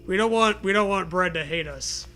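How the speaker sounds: usual speaking voice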